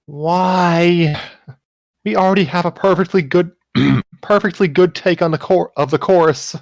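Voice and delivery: reluctant protest. Acted. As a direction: push back, irritated but unsure. Umi protesting in the drama.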